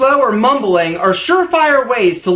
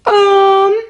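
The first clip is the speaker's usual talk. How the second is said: mock interjection; 9 semitones higher, 4 dB louder than usual. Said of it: "she would say".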